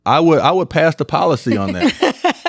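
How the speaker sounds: laughing through the answer. Real, not acted. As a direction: say it while laughing. Amused answer to a funny question.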